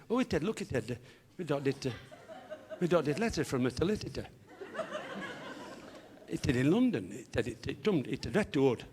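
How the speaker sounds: comic speech defect with t and d replacing other consonants; tongue-tied, childlike t-for-k substitutions; impeded speech, consonants fronted to t and d; Harry. Acted. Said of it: "a slight speech defect".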